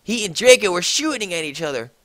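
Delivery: normal talking voice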